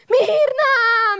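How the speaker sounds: high, loud sobbing cry of the name; weeping father crying out his daughter's name; crying call. Retold or imitated. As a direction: call her name through tears. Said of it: "me decía mi papá llorando".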